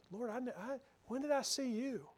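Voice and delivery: humbly amazed, stress on "I"; humble wonder. Shown as a demonstration. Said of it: "Words are almost the same. The emphasis is just a little bit different".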